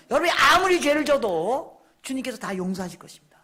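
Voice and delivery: high, emphatic pulpit voice; forceful preaching. Real, not acted. Preacher urging congregation toward holiness, forceful preaching. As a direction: drive it home to the congregation.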